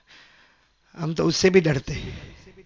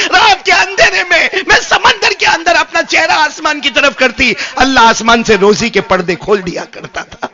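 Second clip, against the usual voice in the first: fish recalling, loud; 6 semitones higher, 12 dB louder than usual. A narrator's reading. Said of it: "ایک جملہ کہا".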